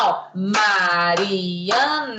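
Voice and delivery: Mariana stretched slowly, syllable by syllable; drawn-out syllables. Shown as a demonstration. Teacher sounding out Mariana slowly to a child.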